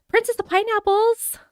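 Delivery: high-pitched happy greeting; real pleased squeal of a hello; delighted greeting. Real, not acted. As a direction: greet an arriving friend in a high lilt. Greeting a viewer who arrives.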